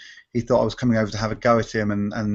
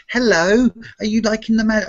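put-on talking down to a child; 10 semitones higher than usual. Shown as a demonstration. Magician showing how he never talks to children, condescending baby-talk. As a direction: put on a high, patronising voice for little kids. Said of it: "talk down to her like a little girl".

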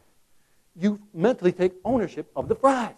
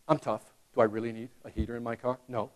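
quieter, faster mock-tough musing; mock tough guy; 7 semitones lower, 11 dB quieter than usual. Minnesotan talking himself out of a heater, mock tough. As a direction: brush off the need like a tough guy. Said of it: "you're like".

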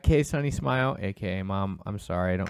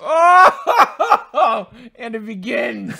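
bursting into high laughter; laughing; 9 semitones higher, 9 dB louder, much slower than usual. Real, not acted. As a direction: laugh out the word. Laughing reaction to roast.

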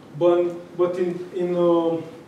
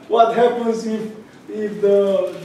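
usual speaking voice

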